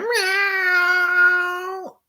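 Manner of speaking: high, whiny mock meow like a puzzled cat; plaintive cat meow. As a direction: mew it out high and mournful, like a cat crying over its toy. Imitating the cat over prey.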